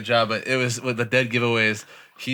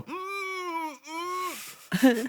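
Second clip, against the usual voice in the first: high, soft muffled "mm"; stuffed animal moan; 6 dB quieter than usual. Retold or imitated. Making the stuffed animal go "mm", high soft moan. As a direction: imitate a toy's faint, high hum. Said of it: "the stuffed animal go".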